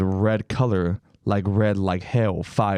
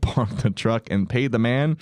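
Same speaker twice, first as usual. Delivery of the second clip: ordinary talk, nothing marked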